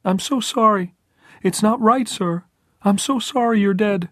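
put-on high, loud, grief-stricken boy; anguished child's wail in words; breaking treble cry. A narrator's reading. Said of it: "cried in a breaking treble voice".

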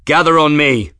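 high, loud rallying shout; hoarse shout. A narrator's reading. Captain rallying his crew in thick dust, shouting. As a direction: shout the order over chaos. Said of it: "Grimm shouted, his voice hoarse".

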